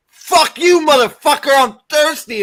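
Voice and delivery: screamed high and loud in outrage; a full-throated, hammed-up furious yell; furious shout. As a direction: roar it at him, seething. Harry's furious retort after water smacked away.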